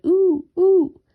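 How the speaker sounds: high, loud hooting ooh; owl's dragon hoot. A narrator's reading. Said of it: "flapped his wings and shouted".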